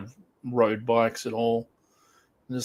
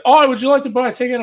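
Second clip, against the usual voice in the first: pushy ticket seller; 10 semitones higher, 8 dB louder than usual. Retold or imitated. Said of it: "the guy just went".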